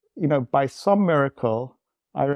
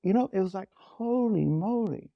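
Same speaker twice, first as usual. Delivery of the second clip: real emotion, voice pitched higher and strained; talking through rising tears; tearing up; 9 semitones higher than usual. Real, not acted. Recalling the moment his marriage turned, tearing up for real. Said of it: "I'm tearing up right now".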